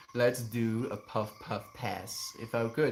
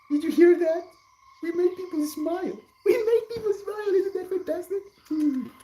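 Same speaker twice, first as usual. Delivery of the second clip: much higher cutesy voice for the puppy, giggly; squeaky pet voice; 4 dB louder than usual. Pretend. Streamer voicing his puppy to chat, high cutesy pet voice. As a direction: speak for the dog in a tiny happy voice.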